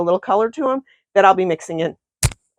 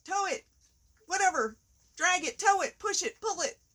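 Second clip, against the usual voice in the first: hammed-up car lot ad voice; used car salesman; 10 semitones higher, 13 dB quieter than usual. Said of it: "I sound like a used car salesman".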